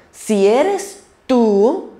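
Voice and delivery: slow, drawn-out "si eres tú" with a hint of doubt; a touch of hesitant doubt; doubtful. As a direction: stretch "si eres tú" as if unsure. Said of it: "Ahí hubo un poquito de duda".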